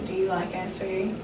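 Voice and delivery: ordinary talk, nothing marked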